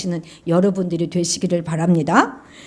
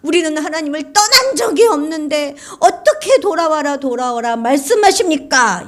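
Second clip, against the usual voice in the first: high, loud, tearful retort; defiant talking back; 9 semitones higher, 4 dB louder than usual. Acted. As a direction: talk back, half crying. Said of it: "이렇게 이게 대드는 거예요".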